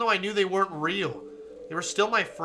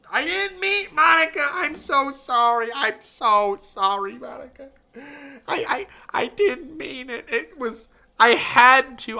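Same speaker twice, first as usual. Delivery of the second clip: high, loud pleading sorrow; mock tearful apology; 8 semitones higher, 7 dB louder than usual. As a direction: beg her forgiveness, overdone.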